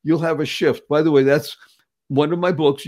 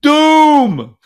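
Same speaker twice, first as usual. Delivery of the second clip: much higher, louder Doom; dramatic exclamation; 10 semitones higher, 9 dB louder than usual. Acted. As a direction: boom it out like doom itself.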